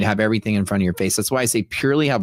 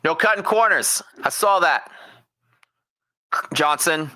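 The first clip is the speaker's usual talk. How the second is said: a gym teacher yelling laps through a bullhorn; megaphone gym coach; 8 semitones higher than usual. Retold or imitated. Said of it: "talking through a megaphone".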